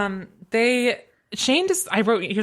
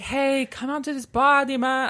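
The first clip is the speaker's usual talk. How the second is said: put-on higher, slowed, half-hearted French Haitian accent; badly faked French Haitian accent, pitched up; attempted French Haitian accent; 6 semitones higher than usual. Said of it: "I can't do a French accent".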